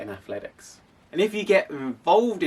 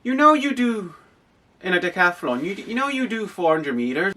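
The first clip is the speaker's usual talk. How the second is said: imitating the coach in a higher Irish accent; 6 semitones higher than usual. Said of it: "in my best Irish accent".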